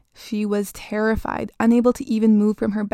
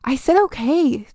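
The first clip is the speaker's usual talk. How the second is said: put-on louder, higher teenage snap; shout; 4 semitones higher, 4 dB louder than usual. Girl shouting back at her nagging mother. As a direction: yell it back at her mom. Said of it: "Jamie shouted".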